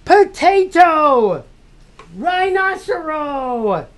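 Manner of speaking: high, loud stage magician; magician's voice. Pretend. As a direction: announce it like a showman. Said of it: "find my magician's voice".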